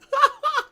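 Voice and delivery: high loud laugh; burst of laughter. Real, not acted. Laughing at his own outburst to viewers. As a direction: break into a big laugh.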